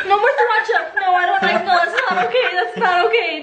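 laughing protest. Real, not acted. Laughing protest at spicy item.

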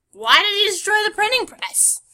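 loud, high indignant outburst; outraged. Real, not acted. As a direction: demand an answer, outraged. Outraged question at game event.

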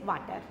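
"water" begun with a v, like "vater". Shown as a demonstration. Teacher showing the class the mistake, "water" with a v sound. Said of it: "It's not water".

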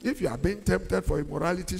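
normal talking voice

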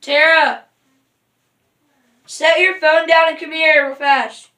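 loud call across the house. Real, not acted. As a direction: shout for her to come. Calling sister from another room.

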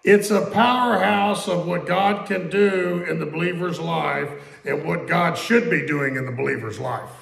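ordinary talk, nothing marked

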